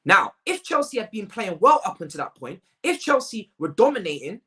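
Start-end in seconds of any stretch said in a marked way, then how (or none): none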